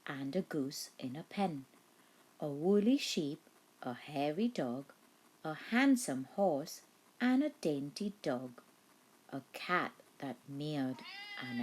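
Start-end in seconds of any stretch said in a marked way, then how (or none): none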